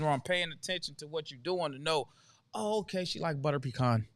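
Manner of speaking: ordinary delivery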